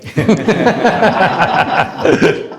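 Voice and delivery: loud burst of real laughter; hearty laugh. Real, not acted. Speaker recalling the sherry offer, laughing loudly.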